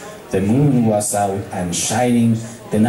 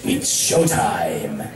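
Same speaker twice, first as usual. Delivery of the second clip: cocky; 7 semitones lower, much slower than usual. Acted. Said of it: "cocky grin".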